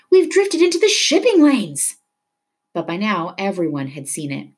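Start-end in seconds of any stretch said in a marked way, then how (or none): 0.1-2.0 loud, high alarmed yell. A narrator's reading. Leto warns everyone in the boat, yelling. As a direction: yell a warning at the top of the range. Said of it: "Leto yelled".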